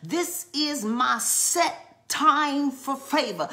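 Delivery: slow, firm, each word of "set time" landed; declared with conviction. Shown as a demonstration. Preacher modelling the declaration for the congregation, firm. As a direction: say it like you know your name. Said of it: "say it like you know your name".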